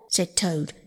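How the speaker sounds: plain reading voice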